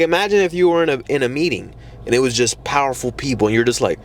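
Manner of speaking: ordinary talk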